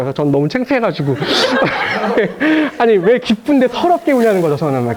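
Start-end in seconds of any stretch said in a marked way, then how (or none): 1.2-2.7 long high laugh; drawn-out amused laugh. Real, not acted. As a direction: laugh at your own embarrassment. Embarrassed by crying students.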